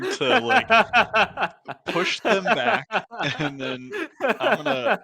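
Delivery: laughing loudly while talking. Real, not acted. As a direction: laugh through the words. Laughing while pushing them back.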